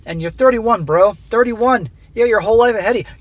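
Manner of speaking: ordinary delivery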